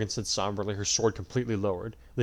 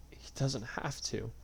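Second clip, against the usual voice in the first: tearful; 9 dB quieter, slower than usual. A narrator's reading. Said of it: "tears falling down her cheeks".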